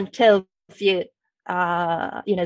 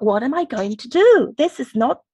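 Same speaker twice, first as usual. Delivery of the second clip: higher-pitched, mildly desperate self-quote; retold desperation; 4 semitones higher, 4 dB louder than usual. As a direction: replay your own helpless inner panic. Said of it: "I was desperate 'cause I thought".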